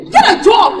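wife jeering at her jobless husband; angry shout. Acted. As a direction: berate him with a laugh in it.

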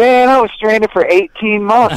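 high-pitched joking protest; mock-indignant, pitched well up; mock exasperation. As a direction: protest in playful disbelief. Joking protest at being asked about stranding.

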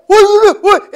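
frantic high yell of a bleeding friend; freaking out. Retold or imitated. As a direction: play the buddy panicking over his bleeding ear. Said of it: "And he's freaking out".

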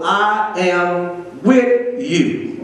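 "I am with you" dragged out word by word; very slow. Real, not acted. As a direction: draw each word out. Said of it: "spend a minute just right there".

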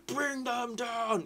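high, drawn-out mock war cry; Lord of the Rings battle cry. Gamer quoting Lord of the Rings, mock battle cry. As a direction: stretch it out like a film battle cry. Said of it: "It's like Lord of the Rings".

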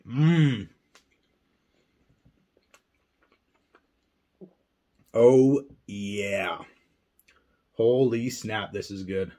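chewing while talking; speaking between bites; mouth full. Real, not acted. Seen 0: Chewing after a bite at -7; holds the sub and keeps chewing while talking.

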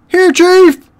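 very high, loud squeal; shrieked minion reply; eager underling. Acted. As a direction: squeak back to the boss. Underling answers the roll call.

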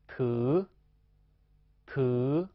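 特 held long, dipping then rising; fifth tone. Shown as a demonstration. Teacher models fifth tone for students, 特 long and rising. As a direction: say 特 with a long vowel climbing at the end. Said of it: "读五声".

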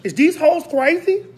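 high, loud outraged family voice; a relative ranting loudly on the phone; family members. Retold or imitated. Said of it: "My family was calling me".

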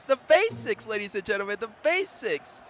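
usual speaking voice

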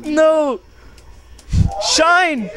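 loud high yells at the screen; shouting. Real, not acted. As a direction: yell at the game in the heat of it. Yelling at the match.

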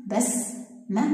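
slow, drawn-out بسمة with a rising end; slow syllable demo. Shown as a demonstration. Teacher to first grade, slowly sounding out the word. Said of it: "نأتي إلى كلمة".

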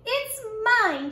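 put-on a stubborn kid's yell. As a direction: yell it to win the argument. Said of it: "she yelled".

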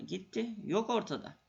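normal talking voice

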